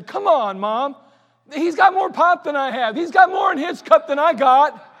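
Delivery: put-on loud, high childish complaint to Mom; whiny kid's protest. Young son shouting at his mom over the pop. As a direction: yell it like a cheated kid.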